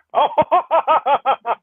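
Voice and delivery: long string of ho-ho-ho laughs; gloating laugh. Real, not acted. Debater recalls defeating a rival, gloating laugh. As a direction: laugh it out in repeated, even bursts. Gloating over beating Jim Bob.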